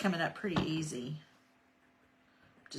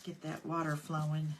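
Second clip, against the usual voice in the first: ordinary delivery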